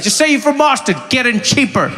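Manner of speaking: high, loud mimicry of an audience member; imitated confident advice. Audience member advising the visitor, high brazen mimicry. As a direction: toss it out as brazen insider advice. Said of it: "I like how conf".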